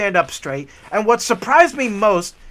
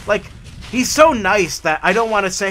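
ordinary talk

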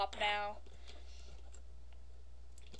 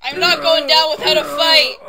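loud battle cry; defiant yell; 3 semitones higher, 18 dB louder than usual. Real, not acted. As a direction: shout it out defiantly.

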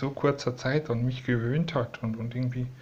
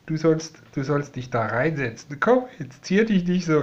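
normal talking voice

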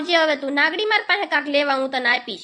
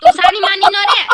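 angry scolding; 3 semitones higher, 8 dB louder than usual. Acted. Sibling scolds loudly.

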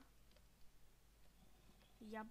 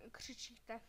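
usual speaking voice

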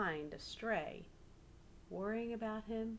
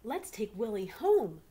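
louder, pleading excitement; eager children; 3 semitones higher, 9 dB louder than usual. Acted. Children begging their parents to keep Willie, eager. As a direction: beg to keep the dog.

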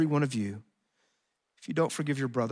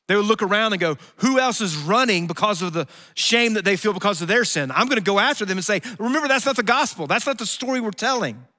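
much higher, louder preacher's cry; impassioned preaching; 8 semitones higher, 7 dB louder than usual. Real, not acted. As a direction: drive it home with full force. Preacher building to a peak.

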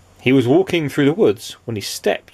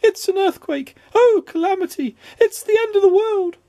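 hysterical, very high-pitched wailing; panicked cry; 3 dB louder than usual. A narrator's reading. Mister Jelly sure the house is falling, panicked cry. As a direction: wail each disaster in a squeaky, frightened voice. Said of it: "he cried".

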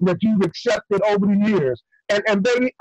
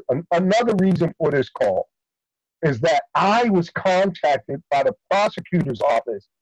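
ordinary talk, nothing marked

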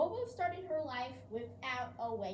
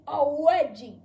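insisting loudly; 2 semitones higher, 12 dB louder than usual. Acted. Dad argues back to mom.

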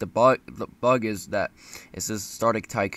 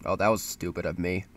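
ordinary talk

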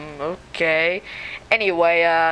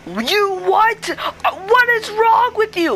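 indignant squawk; 8 semitones higher, slower than usual. Acted. Spider Chicken scolds Spider-Man.

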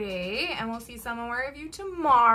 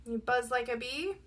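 usual speaking voice

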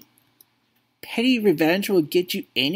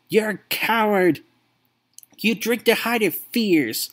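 higher and louder, building to a furious outburst; angry accusation; 6 semitones higher, 3 dB louder than usual. Acted. Son confronting the father who abandoned him, furious build. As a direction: throw every hurt back at him.

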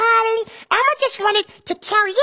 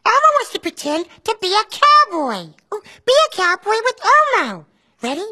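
Elmo voice. Acted. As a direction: speak as cheerful Elmo to a child. Elmo's voice throughout.

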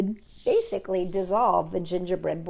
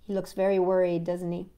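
ordinary delivery

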